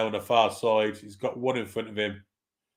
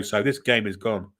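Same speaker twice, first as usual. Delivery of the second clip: normal talking voice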